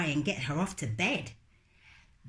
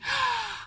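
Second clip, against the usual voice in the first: high little surprised gasp; sharp gasp. Acted. Lola gasping at the toothbrush, high. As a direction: catch your breath in surprise.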